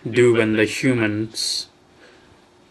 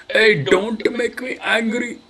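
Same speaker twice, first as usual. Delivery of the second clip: high, slow mock warning; mock angry; 10 semitones higher, slower than usual. Streamer scolding slow software, hammed-up high warning. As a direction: ham up the threat.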